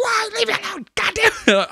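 very high squeal, begging the actor; mock terrified scream. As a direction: shriek it up high, pleading to be left alone. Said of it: "I scream again".

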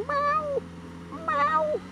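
very high, thin plaintive mewing; hurt kitten meow. Retold or imitated. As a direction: mew like a small injured cat. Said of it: "Mia in the middle of the floor going".

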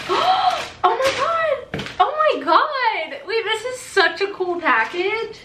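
high, drawn-out squeals of disbelief; real excitement. Real, not acted. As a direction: gasp and gush over the surprise. Opening a surprise PR package.

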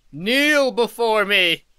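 slow booming command, drawn out; ancient rasping undead king. Pretend. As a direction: demand he bow, slowly and grandly. Commanding another player to kneel.

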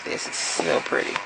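breathy hushed whisper over the card; whispered admiration. Real, not acted. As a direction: whisper it as if in awe.

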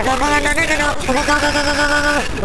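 high, loud rapid-fire alarm; urgent yelps while fleeing in a game; panicked. Real, not acted. Spots Baldi and flees in game.

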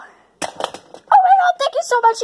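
high, stretched squeal of gratitude; overjoyed thanks. Acted. Character thanking the Easter Bunny, overjoyed. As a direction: gush with excitement.

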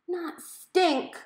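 high, loud, sharp rebuke; snapped correction. A narrator's reading. Mom correcting the computer, snapping loud and high. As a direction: snap it to fix the mistake. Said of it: "Mom snapped".

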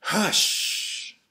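a sharp, loud shushing hush. Acted. Mother shushing the night animal, loud shush. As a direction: shush the noisy creature hard.

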